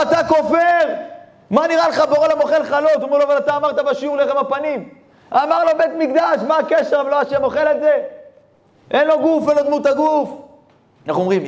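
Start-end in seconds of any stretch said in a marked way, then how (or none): 0.0-10.3 angry rebuke. Acted. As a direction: berate him as a heretic, much higher. Rabbi scolds the heretic.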